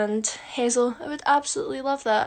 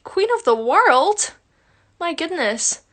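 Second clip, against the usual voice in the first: surprised; 5 semitones higher, 6 dB louder, slower than usual. Real, not acted. Surprised at a question.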